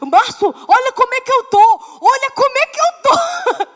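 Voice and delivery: high, loud desperate plea. Retold or imitated. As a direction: beg him to see the state she's in.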